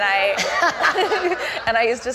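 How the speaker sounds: laughing. Real, not acted.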